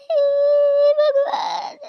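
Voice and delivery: high, slow, shouted sobbing call; loud wailing hey Bhagwan; sobbing cry to God. Acted. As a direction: cry out to God in full wail. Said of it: "आपको रोना नहीं है बिल्कुल".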